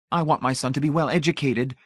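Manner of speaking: high, gushy confiding; gushing society lady. A narrator's reading. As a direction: pour it out sweetly. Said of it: "she confided gushily".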